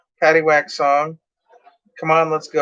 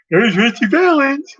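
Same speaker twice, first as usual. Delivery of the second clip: mock DJ; 10 semitones higher, 5 dB louder than usual.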